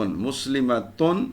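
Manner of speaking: مسلمتن with short "ma" and a firm "tun"; slow "muslimatun" for مسلمتن, rising at the end; un case ending. Shown as a demonstration. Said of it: "یہ آپ کی واحد کی ہوگی".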